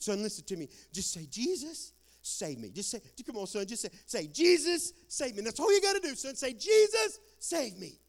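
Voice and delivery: high, loud cries of Jesus, save me; a father begging his dying son; desperate pleading father. Retold or imitated. Father begs his dying son to pray, high and loud.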